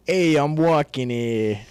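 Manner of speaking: slow, drawn-out, loud New York accent. Shown as a demonstration. Friend redoes accent on request, broad New York. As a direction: stretch out a hammed-up New York street shout. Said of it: "do your best New York accent".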